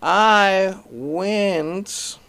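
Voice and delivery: high, loud, drawn-out storyteller voice; Cosby impression. Comic imitating Bill Cosby's story hook, high and loud. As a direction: hang on each word like Cosby. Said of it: "keep you in a story by saying".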